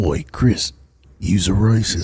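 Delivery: low mock British hard man; Jason Statham. Accusing Chris of racism, low impression. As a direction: drop low for a British tough-guy accent. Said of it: "Jason Statham".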